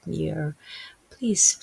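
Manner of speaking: normal talking voice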